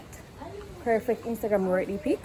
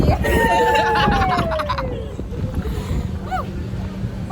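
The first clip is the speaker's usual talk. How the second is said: high shrieking burst of laughter. Real, not acted. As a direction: scream with laughter. Huge laugh after toast.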